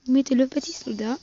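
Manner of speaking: ordinary talk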